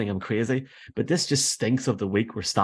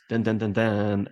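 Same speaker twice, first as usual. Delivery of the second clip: mock fanfare; slower than usual. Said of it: "we've got a new segment alert".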